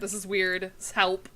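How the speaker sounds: ordinary talk, nothing marked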